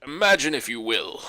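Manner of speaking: a hint of the Twilight Zone narrator; ominous host intro; Rod Serling impression. [name] doing the show's narrator, lightly voiced. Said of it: "the perfect Rod Sterling".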